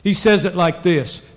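normal talking voice

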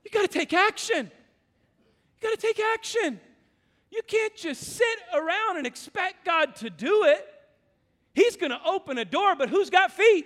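loud, high fired-up preaching; preaching at full force. Real, not acted. Preacher urging the church to act, full force. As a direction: drive the point home to the congregation.

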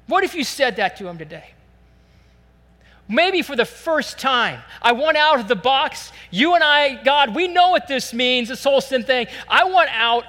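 high, loud pleading sermon; fervent preaching. Acted. Pastor voicing a sinner's prayer, fervent preaching.

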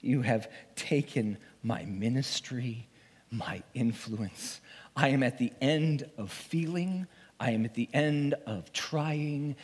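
ordinary talk, nothing marked

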